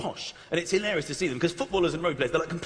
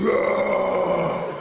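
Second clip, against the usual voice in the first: a Samoan forward roaring at the winger; mock roar; 4 semitones lower than usual. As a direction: growl it like a big forward. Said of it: "some big Samoan is standing there going".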